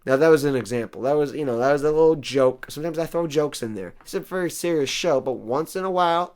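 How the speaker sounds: ordinary talk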